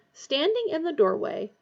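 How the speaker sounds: plain reading voice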